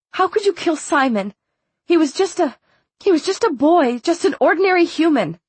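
high-pitched, anguished accusation; heated demand. A narrator's reading. Daughter confronts father over killing Simon, heated demand. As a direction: push the pitch up, distraught and demanding. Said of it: "she demanded".

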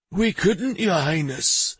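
high-pitched, mildly pleading; a touch of pleading, pitched well up; nearly pleading. A narrator's reading. Mayor excusing himself to His Highness, nearly pleading. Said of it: "in a tone that was nearly pleading".

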